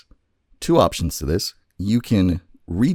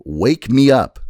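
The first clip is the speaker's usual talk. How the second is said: louder, higher cry to be woken; narrated yell; 4 semitones higher, 6 dB louder, slower than usual. A narrator's reading. Game master voices the sleeper trying to wake, narrated yell. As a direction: call it out loud within the narration. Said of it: "You try to yell".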